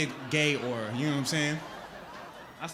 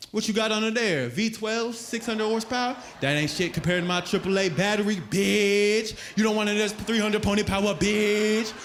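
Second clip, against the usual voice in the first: trash talk; 5 semitones higher, 4 dB louder than usual. Retold or imitated. Taunting Lamborghini at stoplight.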